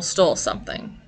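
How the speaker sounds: ordinary talk, nothing marked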